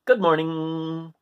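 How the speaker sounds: slow, drawn-out buzzing greeting; a long whizzing hello; whizzing locust. Acted. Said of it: "whizzed the locust".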